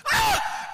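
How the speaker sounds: very high, loud shriek of pain; imitated piercing scream; sudden scream. Wife stung in bed, sudden pained scream. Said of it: "And she screams".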